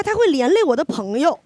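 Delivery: ordinary delivery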